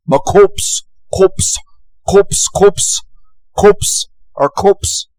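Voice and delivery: high, strained creature voice; creepy creature voice. Retold or imitated. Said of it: "I can't stand that creature. He's creepy".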